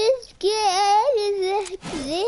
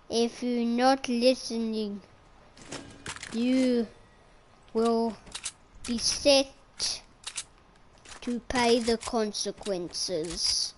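pretend solemn threat; 6 semitones lower, 6 dB quieter than usual. Mock threat to another player.

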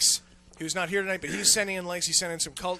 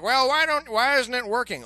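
mock complainer; 5 semitones higher, 4 dB louder than usual. Mocking complaining users.

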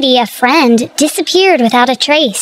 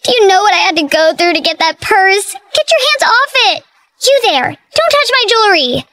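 high, frantic scolding of the movers; shrill frantic cries; 7 semitones higher, faster than usual. Acted. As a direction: shoo them off her things. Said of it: "Her cries filled Brady's ears".

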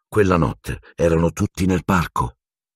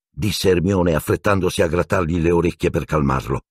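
ordinary delivery, nothing marked

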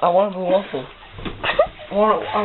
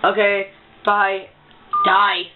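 usual speaking voice

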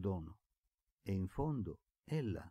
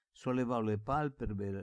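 plain reading voice

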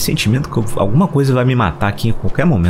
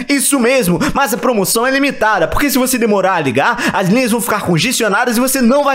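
higher, pushy infomercial pitch; TV ad announcer; 11 semitones higher than usual. Acted. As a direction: sell it like a late-night infomercial. Parody TV shopping ad.

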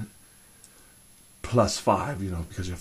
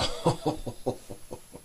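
long breathy run of ho-hos; airy, trailing laugh; amused chuckle; 5 semitones higher, 6 dB quieter than usual. Acted. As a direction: chuckle at your own jibe. Chuckles at jibe about bureaucrats.

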